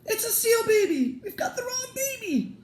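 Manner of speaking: shrieked very high and loud; panicked scream at the baby; yelling in shock. Acted. Father shocked by the seal baby, high panicked shriek. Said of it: "He yelled".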